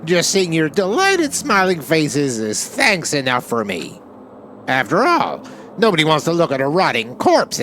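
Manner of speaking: high, loud, gleeful mascot voice; Monokuma. Acted. Bear mascot gleefully announcing the corpse cleanup to students, high and loud. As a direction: play the cheerful villain bear delighting in the corpses. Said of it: "Monokuma told us".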